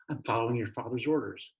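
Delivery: ordinary delivery, nothing marked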